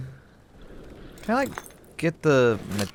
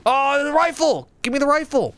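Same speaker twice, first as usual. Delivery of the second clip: high, loud, urgent yelling for the rifle; excited cry; 4 dB louder than usual. Real, not acted. Gamer mid-fight shouts for the rifle, high and urgent. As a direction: call out for the rifle like it's life or death.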